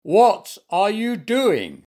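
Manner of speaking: slow, high, loud outrage; roaring. A narrator's reading. Gardener confronting the bear in his garden, roaring. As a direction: demand it angrily, stretching every word. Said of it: "he roared".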